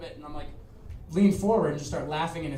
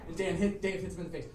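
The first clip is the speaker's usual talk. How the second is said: usual speaking voice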